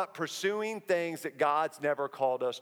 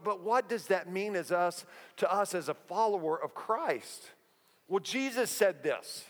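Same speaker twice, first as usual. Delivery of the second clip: ordinary talk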